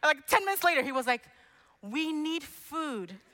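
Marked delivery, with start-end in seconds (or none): 1.8-3.2 put-on slow, drawn-out hungry child whine; whiny kid voice. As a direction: play the starving little boy pleading at the door. Son begging mother for food.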